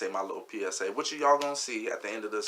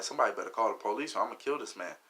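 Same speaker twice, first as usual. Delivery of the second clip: ordinary delivery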